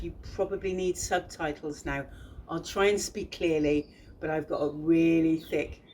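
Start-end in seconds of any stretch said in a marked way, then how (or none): none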